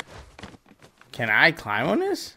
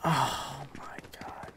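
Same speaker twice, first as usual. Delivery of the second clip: whisper; 11 dB quieter, slower than usual. Real, not acted. Shocked by game event.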